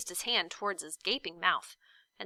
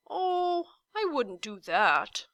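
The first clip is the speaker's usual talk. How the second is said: slow, musing giant voice; thoughtful giant; 5 semitones higher, 4 dB louder, slower than usual. A narrator's reading. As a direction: weigh it over slowly. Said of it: "said the giant thoughtfully".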